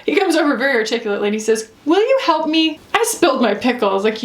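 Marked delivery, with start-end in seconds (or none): 1.8-3.9 put-on higher, pleading little-kid voice; a light hint of a small child asking; toddler voice. Voicing toddler son.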